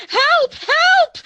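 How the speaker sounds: high, loud terrified cries; screaming for help. Acted. Neighbour girl seized by the intruders, screaming for help. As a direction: scream for help as you're grabbed.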